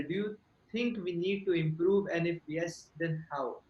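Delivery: normal talking voice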